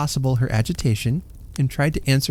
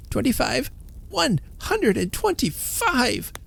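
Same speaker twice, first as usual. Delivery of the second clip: excited counting; 12 semitones higher than usual. A narrator's reading. Counting money in rising joy.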